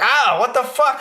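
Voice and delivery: squeaky high surprised shout; high pitched startled squeal; high startled yelp. Retold or imitated. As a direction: yelp as it sticks you again. Sits on it again.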